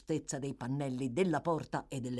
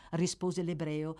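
usual speaking voice

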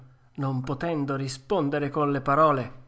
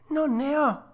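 high puppet voice; 11 semitones higher than usual. Acted. Pinocchio mimes having nothing.

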